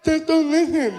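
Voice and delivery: loud, high urging in imitated deaf speech; exasperated deaf-man voice; partially deaf fan. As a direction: insist loudly with blurred consonants. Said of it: "he was partially deaf".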